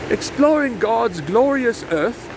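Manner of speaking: high, slow, grand explorer proclamation; Chris Cass impression. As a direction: proclaim it like a pompous colonial explorer. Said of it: "as Chris Cass would say".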